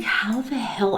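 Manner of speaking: astonished under-the-breath whisper; whispered disbelief. Retold or imitated. Disbelief at slow eating.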